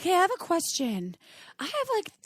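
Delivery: high, hesitant voice of an embarrassed friend; a lightly voiced awkward friend; imitating a friend. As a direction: mimic a friend asking a shy question. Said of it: "friends now that call me".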